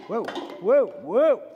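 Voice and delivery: startled whoa. Real, not acted. Preacher as his bottle goes over mid-sermon, startled. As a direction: blurt it out as something topples.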